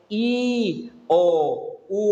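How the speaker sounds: each vowel said apart, with pauses between; spelled-out vowels. Shown as a demonstration. Preacher spelling the vowels to the congregation, one at a time. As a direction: spell out the five vowels for the church. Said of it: "vowels man to".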